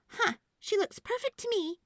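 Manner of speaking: put-on little girl voice. A narrator's reading. Girl defending squash to dad, high and hurt. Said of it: "Sophie replied".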